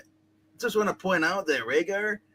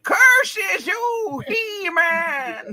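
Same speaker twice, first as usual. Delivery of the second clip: much higher, louder anguished villain shriek; cartoon villain cry; 13 semitones higher, 4 dB louder, much slower than usual. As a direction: curse the hero in a shriek. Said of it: "How do you feel about He-Man?".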